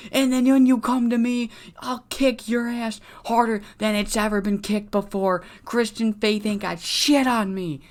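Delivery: hammed-up furious heel yell; mock yell. Crippler threatening the beaten-down wrestler, hammed-up high yell. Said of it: "Crippler's yelling".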